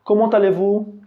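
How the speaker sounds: high questioning "Comment allez-vous?"; asking voice. Shown as a demonstration. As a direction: play the asker.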